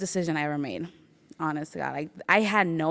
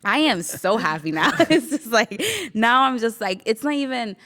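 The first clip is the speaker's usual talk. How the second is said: higher and louder, laughing happily; real joyful laughing; 6 semitones higher, 7 dB louder than usual. Real, not acted. Asked if she is happy, laughing with joy. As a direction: let the joy spill out in laughs.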